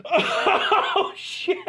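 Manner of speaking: ordinary talk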